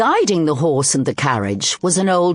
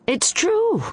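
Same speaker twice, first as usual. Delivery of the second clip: higher, delighted; old man proudly insisting, much higher; beaming. Acted. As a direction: beam with pride as you say it. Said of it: "the old man beamed".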